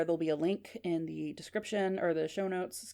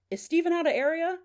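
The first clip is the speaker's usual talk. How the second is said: high call to parents in the next room; 10 semitones higher, 4 dB louder than usual. Acted. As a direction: raise the pitch and call through the house. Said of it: "He calls out to his parents".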